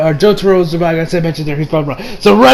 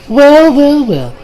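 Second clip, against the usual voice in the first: imitated taunting welcome; knowing villain greeting; 7 semitones higher, much slower than usual. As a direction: start high and let the last word sink. Same character's taunting entrance again.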